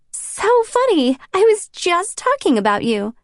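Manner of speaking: high, bright phone greeting; gushing with delight; bubbly excitement. Acted. Abby answers phone cheerfully.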